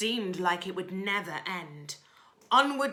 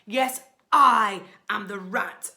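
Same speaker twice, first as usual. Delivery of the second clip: booming bandit rat; a hammed-up villain's boast; loud boast; 2 semitones higher, 12 dB louder than usual. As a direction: proclaim it to the whole road.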